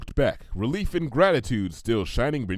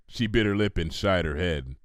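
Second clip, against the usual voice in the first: plain reading voice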